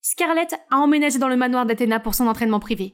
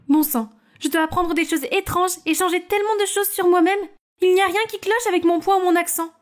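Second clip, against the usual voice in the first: put-on higher, lively girlish voices; teen sisters chatting; 5 semitones higher than usual. Scarlett vents to her sister Naomi, higher teen voices. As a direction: vent then cheer up.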